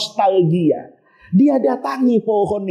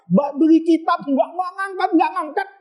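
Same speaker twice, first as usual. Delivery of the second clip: usual speaking voice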